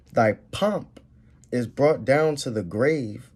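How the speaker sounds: slow, weighty verse; slow solemn reading; scripture reading. Acted. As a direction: let the doom land slowly. Reads scripture.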